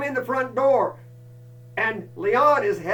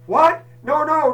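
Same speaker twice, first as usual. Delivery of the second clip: shocked; 7 semitones higher, 9 dB louder than usual. Retold or imitated. Shocked reply to dog news.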